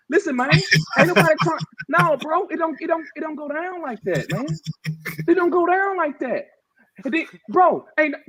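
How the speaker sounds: really fired up, pushing hard; real fired-up ranting. Real, not acted. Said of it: "I'm a little fired up".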